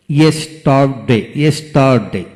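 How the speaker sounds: Yesterday in three beats, yes, tar, de; split into syllables. Shown as a demonstration. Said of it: "যতটুকু অংশ একসাথে উচ্চারণ".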